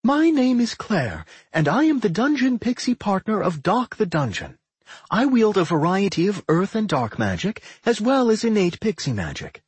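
high pixie voice, playing along formally; pixie introducing herself. A narrator's reading. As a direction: announce yourself in a high, proud pixie voice.

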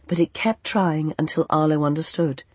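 plain reading voice

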